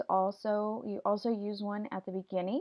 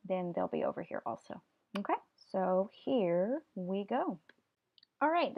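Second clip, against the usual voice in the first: ordinary delivery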